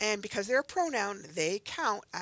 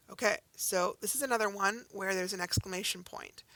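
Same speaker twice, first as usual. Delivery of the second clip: ordinary delivery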